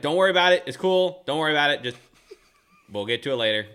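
high, loud fake-breezy reassurance; forced cheerful reassurance. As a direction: oversell that it's totally fine. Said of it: "I'm like".

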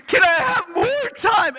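put-on high, whining child voice; high child's wail, slowed and drawn out; whiny child. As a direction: plead like a kid short on time.

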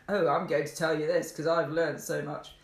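loud, self-important parody; mock know-it-all. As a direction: announce it like a know-it-all showing off. Mocks preachy way of advising.

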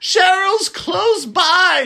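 high, slow eerie whisper-shout; creepy old lady voice. Retold or imitated. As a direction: stretch it out like a spooky grandmother. Nana Rose voiced on phone.

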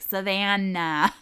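"Savannah" dragged out slowly and loudly; mock slow shout of "Savannah"; slow loud yelling. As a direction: spell it out slowly as if to idiots. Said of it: "yell at them and say it super slowly".

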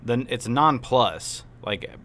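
drawn-out, slow matter-of-fact explanation; slow explaining. Retold or imitated. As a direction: spell it out slowly like a patient clerk. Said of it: "He said".

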